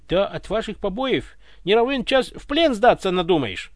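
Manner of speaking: high, loud offended complaint; hurt reproach. A narrator's reading. Ivan reproaches guards over beating, hurt. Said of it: "обиженно выговорил Иван".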